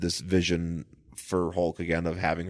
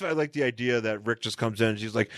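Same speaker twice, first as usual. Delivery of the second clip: normal talking voice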